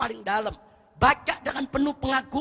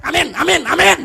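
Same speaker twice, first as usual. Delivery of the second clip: loud, higher, rapid-fire amens; mocking shouted amen; 4 semitones higher, 13 dB louder than usual. Youngster at the back rushing the ustadz's prayer, impatient yelling. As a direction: yell amen impatiently, one after another. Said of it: "di belakang dia teriak".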